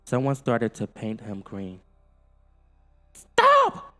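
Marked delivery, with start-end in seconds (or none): 3.1-3.9 high, loud alien cry; alien yell. A narrator's reading. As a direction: yell it out in alarm. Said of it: "yelled Alf".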